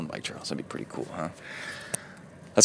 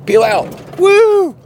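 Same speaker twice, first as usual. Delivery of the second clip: sudden high thrilled woo; excited whoop; 8 dB louder, much slower than usual. Real, not acted. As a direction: yell out at the spinning tires. Car tires spin, excited whoop.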